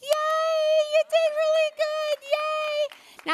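very high, loud cheering; cheering praise. Real, not acted. Preacher cheering the congregation for their chant, high and excited. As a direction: squeal with delight at the crowd.

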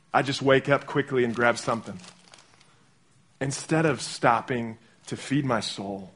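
normal talking voice

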